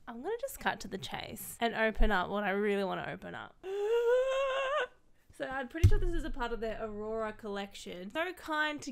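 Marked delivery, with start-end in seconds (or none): 3.6-4.9 high, loud gasp; big sharp intake of delight; excited gasp. Real, not acted. Creator opening a gift box, excited gasp.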